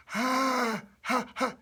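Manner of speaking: high, tight, airy sound; old person. Shown as a demonstration. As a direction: squeeze the throat and push out air. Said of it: "pretty tight in your throat".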